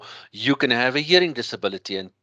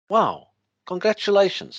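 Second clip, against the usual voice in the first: excited; 8 semitones higher, 3 dB louder than usual. Pretend. Example of an appreciative listener's response.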